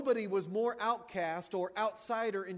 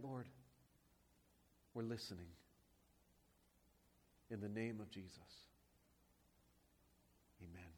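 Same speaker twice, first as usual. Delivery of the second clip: hushed, reverent prayer voice; quiet earnest pulpit prayer; 6 semitones lower, 5 dB quieter than usual. Acted. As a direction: pray it softly to the Lord. Pastor leads opening prayer.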